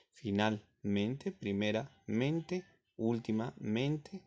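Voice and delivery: each -mente word drawn out slowly; slow listing. Shown as a demonstration. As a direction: spell out the endings slowly for pupils. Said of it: "siempre deben culminar en mente". (enumeración lenta y marcada)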